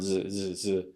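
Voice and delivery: "z" as a soft isolated buzz. Shown as a demonstration. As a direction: repeat "z" quietly, softly voiced. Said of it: "add a very soft Z sound".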